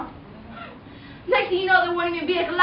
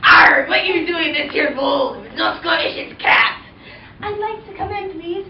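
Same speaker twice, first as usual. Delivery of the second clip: louder gruff pirate brogue with an arr; Scottish pirate; 5 dB louder than usual. Acted. Pirate bouncer Mac blocking Cinderella at the ball, Scottish pirate voice. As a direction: growl it as a one-armed Scottish pirate bouncer. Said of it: "Scottish pirate named Mac".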